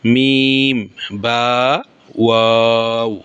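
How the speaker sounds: মিম, বা, ওয়াও each held long, lips closed then rounded; Arabic lip letters. Shown as a demonstration. Teacher drilling the lip letters for students, drawn out. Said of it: "কি কি".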